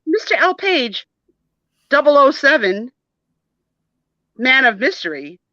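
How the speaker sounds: high, mocking British accent; mimicking British accent. [name] repeats classmate's grand name back, high mock British accent. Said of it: "are you speaking with a British accent?".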